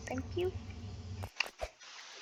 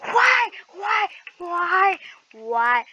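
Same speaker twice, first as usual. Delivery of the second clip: exasperated; 7 semitones higher, 8 dB louder, much slower than usual. Real, not acted. Frustrated at dying.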